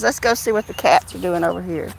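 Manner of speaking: usual speaking voice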